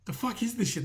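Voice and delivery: high, incredulous mock outrage; mocking fed-up fan. Fans losing their minds at the gig, high mock disbelief. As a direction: sneer it like a baffled punter at a bad gig. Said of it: "they're going".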